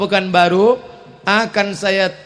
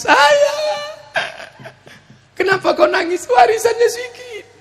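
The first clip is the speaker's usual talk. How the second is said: mock child crying; 3 dB louder than usual. Greedy child at father's death.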